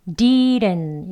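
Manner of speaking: "didn't" with the middle d flapped almost like an l, slowly. Shown as a demonstration. Teacher models native flapped "didn't". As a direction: soften the middle d to a flap. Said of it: "flap D예요".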